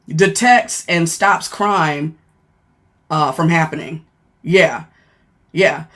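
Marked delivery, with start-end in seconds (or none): none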